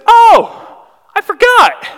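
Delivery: very high, loud imitation of sudden realization; mock surprised outburst. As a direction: shout it like a hammed-up "just remembered". Homeless man's reply, acted out loudly.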